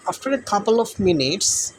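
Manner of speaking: slow, each word spaced out; slow story reading. Shown as a demonstration. Teacher reads the story line to the class. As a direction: read the phrase slowly for pupils. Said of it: "জোকটি শুনে".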